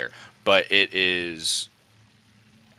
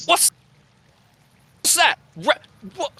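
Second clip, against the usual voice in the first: confused creature voice; 6 dB louder than usual. Acted. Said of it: "Hark is like".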